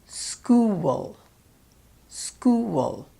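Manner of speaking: "school" slowly as "skoo-wul"; diphthong with mini syllable. Shown as a demonstration. Coach models for learners, "school" with an added mini syllable. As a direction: round "oo", glide through W and schwa into L in "school". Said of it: "release them into a W".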